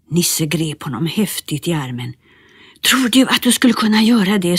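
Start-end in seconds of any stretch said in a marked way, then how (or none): 2.8-4.6 high, quick eager question. Acted. Nisse eagerly asks Bertil about firewood, high and quick. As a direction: leap at the offer. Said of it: "sa han ivrigt".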